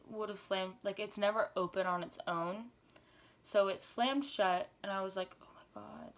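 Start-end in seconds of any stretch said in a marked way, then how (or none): 5.4-6.1 very soft whispered gasp of words; shocked whisper. Retold or imitated. Hears the door slam.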